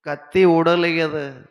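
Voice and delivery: high, loud pleading and demanding; exasperated shout. Retold or imitated. Father loudly asking the crying boy what he wants.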